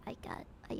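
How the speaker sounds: ordinary talk, nothing marked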